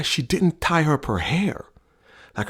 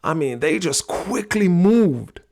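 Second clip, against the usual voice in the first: ordinary talk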